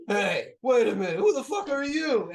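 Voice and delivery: loud demand climbing high in pitch; startled challenge. Acted. Big burly sailor looking down at stranger, startled challenge. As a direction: challenge the stranger, voice rising sharply.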